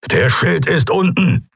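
high, slow, forceful growl; grim, strained alarm; growled report. A narrator's reading. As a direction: growl out the bad news, drawn out. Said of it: "grollte Keru".

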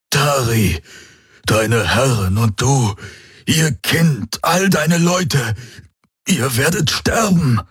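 strained, high, slow cry; choked warning. Acted. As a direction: strain the warning out, rising in pitch. Choked captive warns his attacker.

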